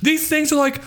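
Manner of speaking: high, indignant mock-outrage; outraged barista rant. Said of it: "I'm so, I'm so inflamed".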